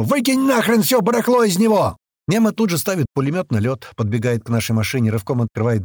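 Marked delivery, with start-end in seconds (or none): 0.0-2.0 high, forceful holler; yelled order. A narrator's reading. Narrator ordering the boot emptied, yelling. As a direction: bellow the command, pitch high throughout. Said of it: "ору ему".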